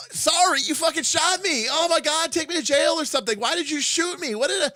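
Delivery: high, loud indignant shriek; outraged whining. Retold or imitated. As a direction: splutter in disbelief. Villain outraged at being shot.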